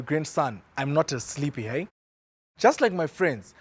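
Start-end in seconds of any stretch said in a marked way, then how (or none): none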